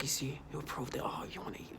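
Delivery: hushed, breathy taunt; half-whispered retold jab; mock whisper. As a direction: whisper it like a sly tease. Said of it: "And you're like".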